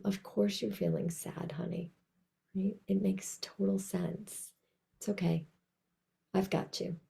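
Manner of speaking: soft, tender reassurance to yourself; tender self-talk. Shown as a demonstration. Speaking kindly to her own sadness, soft and tender. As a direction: soothe yourself like a loved child. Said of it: "I hope you notice my tone of voice".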